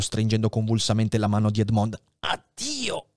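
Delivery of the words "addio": high, soft, breathy-free faint goodbye; faint farewell. Acted. Dying Faria says goodbye to Edmond, faint. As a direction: let it slip out fading.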